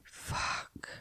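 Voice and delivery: very soft, drawn-out whisper; whispered curse. Real, not acted. Player reacting to the slap, whispered curse. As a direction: breathe the curse out.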